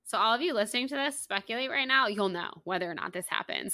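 ordinary talk

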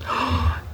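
sharp breathless intake of shock; mock shocked gasp. Preacher feigns shock at the harsh elder, with a breathy gasp. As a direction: gasp as if scandalised.

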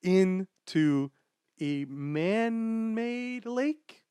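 very slow, stretched reveal; drawn out. Real, not acted. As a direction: savour every word slowly. Drawing out the twist.

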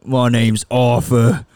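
loud, slow, thick Cockney; Arthur. Acted. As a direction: announce yourself in drawn-out trashy Cockney. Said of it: "my Arthur took over for a second".

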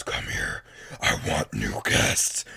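deep, slow, voiceless growl; menacing demonic creature; changed monster voice. Acted. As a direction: rasp it low as a monster hungry for victims. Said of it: "As soon as her voice changed".

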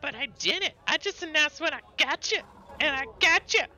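high, loud, squeaky protest; protesting little critter. Acted. Critter defending her sneak attack, shrill protest. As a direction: squeal it like a tiny excitable child.

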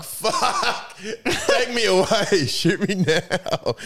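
high, drawn-out wail with laughter; hammed-up agony, stretched and laughing; mock despair. Traveller dreading another Aussie overseas, mock despair.

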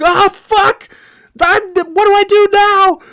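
high, strained panicked shriek; acted panic. Novice driver in a moving truck, acted panic. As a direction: shriek it as if the truck is running away.

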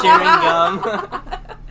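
drawn out through laughter; laughing. Real, not acted. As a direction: squeal it while cracking up. Laughing remark.

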